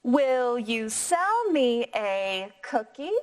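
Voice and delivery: slow, rising question tune. Shown as a demonstration. Teacher modelling Benny's question for the class, slow rise. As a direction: lift the voice at the end. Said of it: "Try that one more time".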